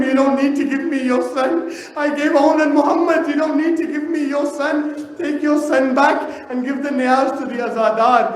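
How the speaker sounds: fervent recitation swelling into a grieving cry; impassioned masaib recitation. Acted. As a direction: lament the story with rising grief and force.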